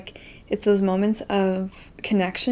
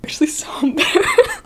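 laughing it out in a breathy whisper, pitched up; embarrassed laughing whisper; 12 semitones higher, 6 dB louder than usual. Real, not acted. Recalling the library spill to viewers, giggling whisper.

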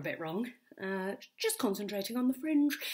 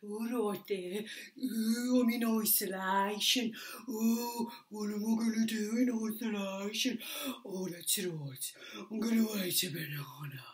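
put-on Birmingham accent; 2 semitones lower than usual. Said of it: "I've got film noir, and I've got Birmingham".

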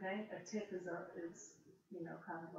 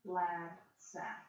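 slow, deliberate reading aloud; slow careful reading; much slower than usual. Shown as a demonstration. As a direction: read it slowly, word by word. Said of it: "let's look at that for just a second".

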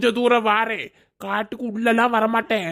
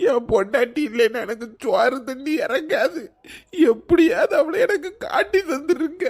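begging through tears; 5 semitones higher than usual. Acted. Old man begs to find wife.

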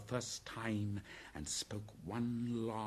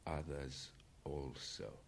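understated panther menace; 6 semitones lower, 12 dB quieter, slower than usual. A narrator's reading. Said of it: "said Bagheera, licking his lips".

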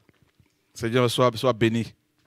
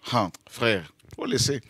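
awed hush; 5 semitones lower, 6 dB quieter than usual. Real, not acted. Awed aside after lightning.

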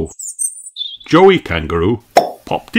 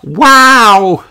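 loud, high amazed cry; big high-pitched wow; gasping in awe; 9 dB louder than usual. Acted. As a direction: marvel like a wonderstruck little girl. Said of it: "gasped Peppa".

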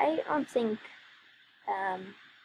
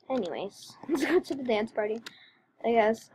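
usual speaking voice

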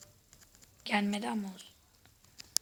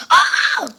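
frustrated scream; 14 dB louder than usual. Real, not acted. Falls off the server again.

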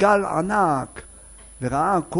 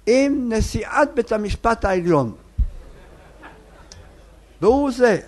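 mother's boast; 7 semitones higher, 4 dB louder than usual. Retold or imitated. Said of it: "והאמא אומרת".

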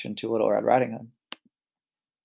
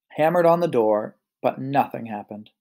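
usual speaking voice

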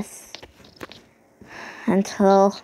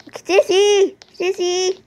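calling out; 6 semitones higher, slower than usual. Real, not acted. Calling Sissy repeatedly.

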